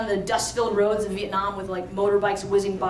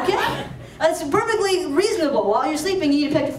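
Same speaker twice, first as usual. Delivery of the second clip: laughing, pitched-up mock agreement; amused sarcasm; 5 semitones higher than usual. Real, not acted. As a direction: fake approval, barely holding back a laugh. Mock approval of cousin's odd habit.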